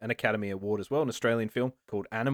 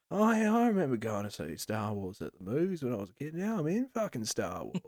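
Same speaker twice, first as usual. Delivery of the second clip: lightly voiced Aussie, softer at the end; lightly Australian excited recollection; light Australian accent; Aussie Mendelsohn; 4 dB quieter than usual. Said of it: "He's like".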